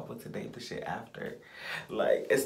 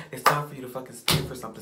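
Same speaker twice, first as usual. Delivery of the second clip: faster and louder, hitting the word fucking; fired-up repeat; 4 semitones higher, 6 dB louder, faster than usual. Real, not acted. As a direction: push it out harder. Repeat raised to a fierce push.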